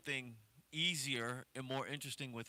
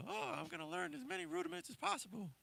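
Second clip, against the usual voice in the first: high mocking voice; mock the eager beginner; mocking naive student; 6 dB quieter, faster than usual. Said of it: "don't pigeonhole yourself into".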